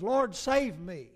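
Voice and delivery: slow, loud cry for help. Retold or imitated. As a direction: call out slowly and loudly to the Lord. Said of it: "and now he says".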